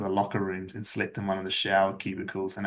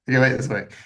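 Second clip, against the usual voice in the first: laughing; 3 semitones higher, 4 dB louder, faster than usual. Retold or imitated. Stubborn self-talk, said twice.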